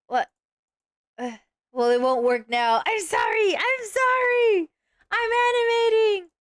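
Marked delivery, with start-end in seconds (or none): 2.9-6.3 mock whiny apology.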